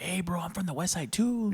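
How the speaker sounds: mock excited hail from a fan; imitated eager fan. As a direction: play a guy excitedly claiming common ground. Imitates fan coming up to him.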